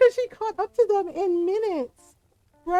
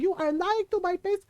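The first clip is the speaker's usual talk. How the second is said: high Filipino-accented tirade, imitated; mock yelling mom; 3 semitones higher than usual. Mom yelling at both brothers, high mock-angry tirade. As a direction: scold both sons as an outraged mother. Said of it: "she's just yelling at him".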